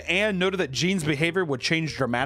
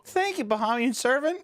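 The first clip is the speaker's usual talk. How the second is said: much higher, lightly voiced little boy; hint of a grateful kid; mock child voice; 10 semitones higher than usual. Gene thanking the servant, high mock child.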